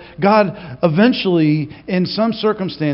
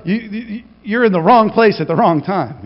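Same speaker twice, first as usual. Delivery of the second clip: ordinary delivery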